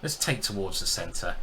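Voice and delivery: usual speaking voice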